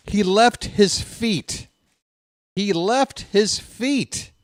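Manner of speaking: "He left his feet" slow, high, each word hammered; emphatic insistence. Real, not acted. Host insisting on the replay.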